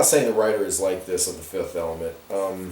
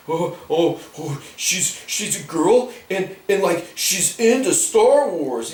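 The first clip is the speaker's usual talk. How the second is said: high, slow gushing nerd voice; swooning nerd, hammed-up; mock excited nerd; 8 semitones higher, slower than usual. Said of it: "You know how it is with these nerds".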